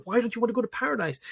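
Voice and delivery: put-on softly asking a crying four-year-old why; gentle question to child. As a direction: ask softly like a father soothing his son. Father gently asks crying son.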